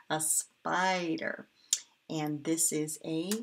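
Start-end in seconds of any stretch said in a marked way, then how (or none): 0.1-1.5 A spider said slowly; slow teaching word. Shown as a demonstration. Teacher repeating spider slowly for the class. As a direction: draw out A spider for kids. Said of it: "never find a spider".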